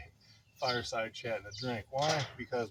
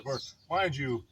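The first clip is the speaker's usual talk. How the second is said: normal talking voice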